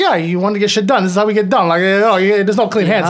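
defiant retort. Retold or imitated. Garak justifying the murder to Sisko, defiant. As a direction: argue back insistently as the character.

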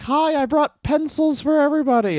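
put-on very high, cheery innocent girl; sweet little angel daughter. Sweet daughter voiced in contrast.

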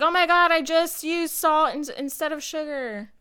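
high, loud panicked gasp; mock panic over a mistake; panicked contestant. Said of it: "They're like".

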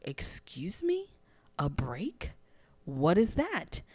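affronted, as if the idea were unheard of; mock offence. Retold offended reply to coach.

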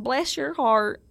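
playful American southern accent. Shown as a demonstration. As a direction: demo a stock southern-accent line. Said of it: "I did a southern accent".

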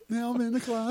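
high, thin cartoon voice; Tom Cruise impression. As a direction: quote it like a cartoon. Quoting Tom Cruise South Park.

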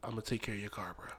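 1 dying whisper. Pretend. Acting out his own dying words.

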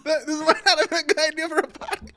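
laughing through the words; a helpless giggle on every word. Real, not acted.

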